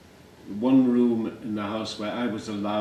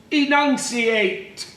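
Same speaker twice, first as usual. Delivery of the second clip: very high, loud, stretched-out "Enunciate!"; teacher's shouted command; 14 semitones higher, 6 dB louder, slower than usual. Acted. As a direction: shriek the order syllable by syllable. Teacher's command acted in poem.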